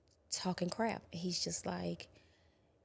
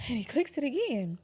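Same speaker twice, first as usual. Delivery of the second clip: higher and louder on again; raised, tense; 6 semitones higher, 5 dB louder than usual. Retold or imitated. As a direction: push it out, rising dread. Retelling gun being clicked.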